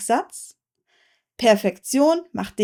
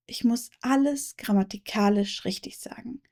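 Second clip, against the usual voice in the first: quiet inner thought; 7 dB quieter than usual. Shown as a demonstration. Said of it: "Perfektionistisch gedacht".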